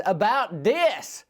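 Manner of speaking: slow, drawn-out mock holler. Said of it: "she hollers at me".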